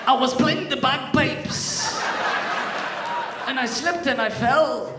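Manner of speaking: put-on loud, high, drawn-out Scottish accent. Bagpiper explaining his fall to doctor, Scottish accent. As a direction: belt it out in a hammed-up Scottish accent. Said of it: "That's that one".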